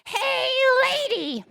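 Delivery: high, drawn-out parrot squawk; talking parrot. Acted. Parrot calls to a lady walking by, high parrot voice. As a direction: stretch it out like a shrill talking parrot. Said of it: "the parrot says".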